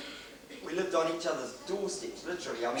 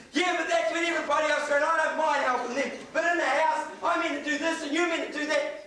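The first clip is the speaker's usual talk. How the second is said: heated retort; 9 semitones higher than usual. Retold or imitated. Husband's defence in the argument.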